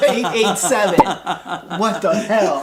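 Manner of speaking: laughing loud in disbelief. Real, not acted. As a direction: crack up while reading it. Laughing at twist.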